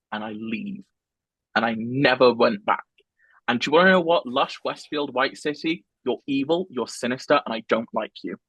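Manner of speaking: ordinary talk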